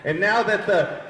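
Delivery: loud, high-pitched rant at someone in the crowd; exasperated loud telling-off; heated heckler takedown. Real, not acted. Scolding audience member mid-show.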